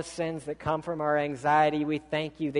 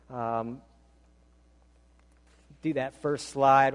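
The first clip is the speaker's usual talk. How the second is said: normal talking voice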